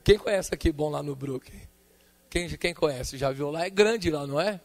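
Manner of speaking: usual speaking voice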